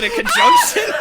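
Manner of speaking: squeaky high laugh through the word. Real, not acted. Friend laughing over the grammar joke, laughing. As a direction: crack up at your own guess.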